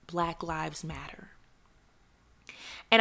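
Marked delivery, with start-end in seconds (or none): 0.1-1.3 slow, soft whisper, almost no voice; hushed whisper of the phrase, deeply felt. Real, not acted. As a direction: breathe it out, overcome. Emotional confession, hushed.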